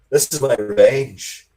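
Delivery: a hint of a grim vampire hunter; hammed-up Van Helsing. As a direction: play the vampire hunter swearing revenge, half joking. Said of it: "I was like Van Helsing".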